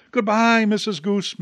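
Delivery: ordinary delivery, nothing marked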